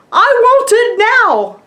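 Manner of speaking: high, loud little-girl tantrum in a bad British accent; slow, demanding British toddler squeal; terrible British accent. Imitating a three-year-old demanding a tiara, terrible British accent. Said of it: "Sorry, terrible accent".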